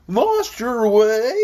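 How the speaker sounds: high, very drawn-out question; questioning bumpy voice. A narrator's reading. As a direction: stretch the question out in a wobbly character voice. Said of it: "said in a rather bumpy voice".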